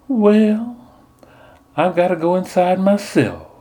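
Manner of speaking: high sugary belle voice; sweet southern belle. Retold or imitated. Nun as Portia sweet-talks the Soothsayer in a belle voice. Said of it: "silky, sweet voice".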